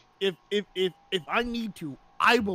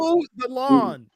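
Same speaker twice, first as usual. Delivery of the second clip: loud slow order; 3 semitones higher, 6 dB louder, slower than usual. Acted. Host repeats order in English.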